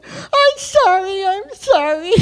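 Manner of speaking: high, loud crying apology; tearful sorry. Retold or imitated. Apologizing to the stranger while crying. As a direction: sob out the apology, high-pitched. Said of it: "And I'm crying".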